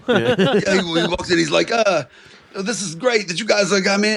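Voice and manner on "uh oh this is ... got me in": high, mock Boston chain-smoker; Boston guy impression. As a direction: put on a raspy Boston patient voice. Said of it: "he sounded like a voice that Carter does".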